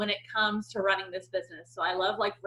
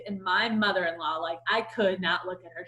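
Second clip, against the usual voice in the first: ordinary delivery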